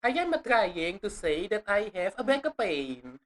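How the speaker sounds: slow, hammed-up Italian accent; playful imitation of an Italian speaker, slowly. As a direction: draw it out in mock Italian-English. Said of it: "my Italian accent actually better".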